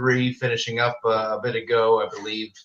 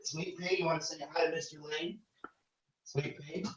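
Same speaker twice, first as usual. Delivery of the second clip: soft, higher-pitched pet talk; coaxing a pet; 5 semitones higher, 15 dB quieter than usual. Real, not acted. Host asks Sweet Pea to say hi to Mister Lane, soft coaxing. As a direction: gently coax with questioning lifts, well under normal volume.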